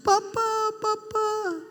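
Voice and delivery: put-on high, pleading child's call; mock a small girl crying for her father, pitched high. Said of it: "Dia nangis". (anak kecil menangis memanggil papanya)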